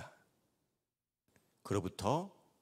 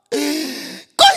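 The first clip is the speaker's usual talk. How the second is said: loud high sobbing wail; 13 semitones higher, 17 dB louder than usual. Retold or imitated. Old pastor bursting into loud wailing sobs. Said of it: "대성통곡을 하시는 거예요".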